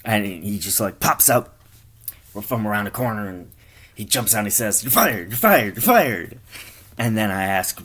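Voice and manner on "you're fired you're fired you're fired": loud, high, repeated pounce; acted boss's outburst. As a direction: jump out and fire him with gusto. Boss jumps out and fires him.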